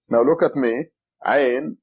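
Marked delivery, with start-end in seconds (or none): 1.3-1.8 throaty Ain from the back of the throat. Shown as a demonstration. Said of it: "it comes from your throat".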